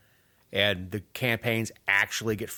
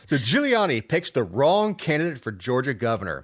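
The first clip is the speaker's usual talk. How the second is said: ordinary talk, nothing marked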